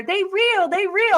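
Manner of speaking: high, insistent seller voice; hustler vouching. Retold or imitated. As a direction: sell the fake ring hard. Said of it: "He lights it on fire".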